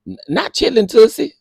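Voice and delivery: high, loud stunned reading of the name; can't believe the words on screen; high and loud, shocked; shocked disbelief. Real, not acted.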